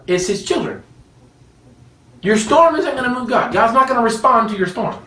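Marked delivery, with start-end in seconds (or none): none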